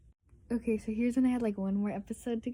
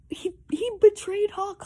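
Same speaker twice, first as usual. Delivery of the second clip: outraged; 11 semitones higher, 9 dB louder than usual. Real, not acted. Outraged retelling.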